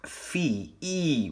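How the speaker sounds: "Fee" held, then the vowel "E" alone; long E sound. Shown as a demonstration. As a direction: isolate and stretch the E in "Fee". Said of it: "We have a long E sound".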